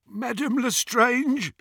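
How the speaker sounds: high, slow, awed greeting; obsequious murmur. A narrator's reading. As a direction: draw the name out high with a gasp. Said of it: "murmured Tom".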